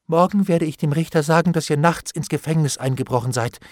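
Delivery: eager, agitated vows with high bursts; frantic promising. A narrator's reading. Bailiff swears to obey Hendon, frantic and eager. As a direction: promise anything in a fluster.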